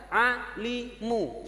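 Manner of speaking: alimu drawn out slowly; slow reading. Shown as a demonstration. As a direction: stretch alimu syllable by syllable. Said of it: "ketika sudah bisa".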